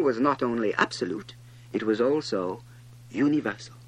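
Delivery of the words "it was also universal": usual speaking voice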